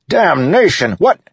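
high, loud startled shout. A narrator's reading. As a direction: shout out in shock. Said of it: "Adolin shouted".

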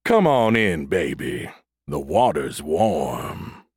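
low, slow seductive croon; smooth-talking seducer. A narrator's reading. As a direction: purr it like a Lothario. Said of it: "smooth-talking Lothario".

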